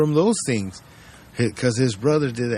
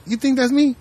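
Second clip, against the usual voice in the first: feigned innocence; 11 semitones higher than usual. Retold or imitated. Suspect denying on film.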